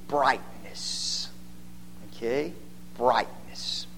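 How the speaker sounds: whisper. Shown as a demonstration. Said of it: "preacher whispers key word".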